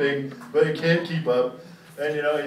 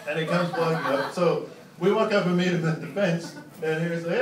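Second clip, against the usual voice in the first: ordinary talk, nothing marked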